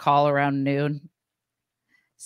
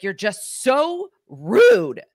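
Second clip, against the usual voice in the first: put-on high, loud, drawn-out indignant kid; slow, exaggerated outburst of offence; mock whiny child; 6 semitones higher, 6 dB louder, much slower than usual. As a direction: imitate a kid whining an accusation, dragging the words. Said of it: "she's like".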